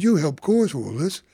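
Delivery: retold angry accusation. Officer blaming him angrily.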